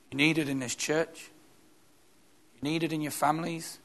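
ordinary talk, nothing marked

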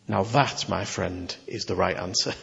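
Jesus answering the repentant thief, low and gentle. Acted. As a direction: answer him low and fond.

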